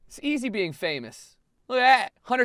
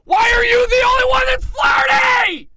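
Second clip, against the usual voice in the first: exasperated yell; 10 dB louder than usual. Real, not acted. Player baffled by flirty character, exasperated yell. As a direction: howl the question in exasperation. Exasperated at wrong game mood.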